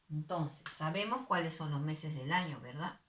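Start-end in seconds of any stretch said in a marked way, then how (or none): none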